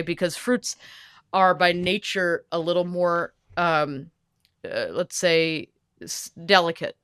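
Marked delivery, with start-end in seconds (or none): none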